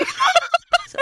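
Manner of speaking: high giggle burst; laughing at the punchline. Real, not acted. Laughing at the recap.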